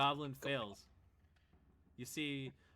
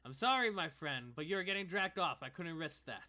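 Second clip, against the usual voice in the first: raised, loud explanation to a comrade; apologetic ally in character; 5 semitones higher, 7 dB louder than usual. Acted. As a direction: call out an apology over the fight. Game master answers in character.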